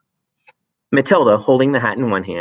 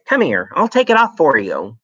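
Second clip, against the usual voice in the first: wife's voice; 6 semitones higher, 4 dB louder than usual. Acted. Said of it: "his wife said".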